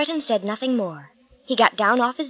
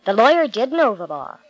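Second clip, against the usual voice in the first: ordinary delivery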